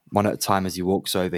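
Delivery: usual speaking voice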